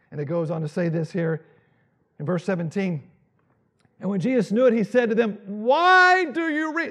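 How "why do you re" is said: high and loud, slowed rebuke. Acted. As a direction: raise the voice at the forgetful disciples. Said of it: "wish the Bible had volume to it".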